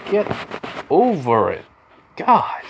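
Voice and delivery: halting words, then a high exasperated burst; spaced-out words, pitch leaping on the last; fed up, jumping up at the end; exasperated telling-off. Acted. Acted exasperated telling-off of Bakugo.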